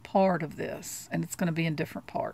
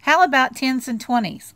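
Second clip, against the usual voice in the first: higher, louder little-sister voice; Sally suggesting; 6 semitones higher, 8 dB louder than usual. Acted. Sally to Charlie Brown, asking for money, higher and coaxing. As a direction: wheedle it as a greedy little sister. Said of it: "she suggested".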